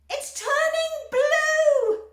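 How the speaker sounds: very high, loud, slow scream; mother screaming at her daughter. A narrator's reading. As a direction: scream in horror. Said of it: "screamed Missus Beauregard".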